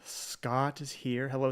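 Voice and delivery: ordinary talk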